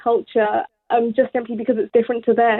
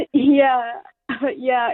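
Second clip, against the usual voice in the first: laughing. Real, not acted. Laughing off a compliment.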